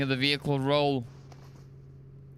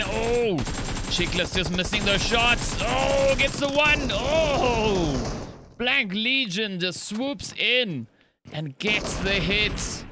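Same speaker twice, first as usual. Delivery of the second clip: excited; 6 semitones higher, slower than usual. Real, not acted. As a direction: erupt over the big play. Caster erupts at a swoop play.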